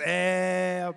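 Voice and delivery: loud, long evasive eh; stalling politician's eh. Retold or imitated. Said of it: "Be like".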